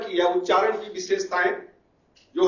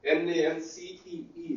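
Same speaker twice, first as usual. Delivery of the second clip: slow, softer letter-by-letter spelling; 7 dB quieter, slower than usual. Real, not acted. Teacher spelling resonance for the class, slowly spelling out.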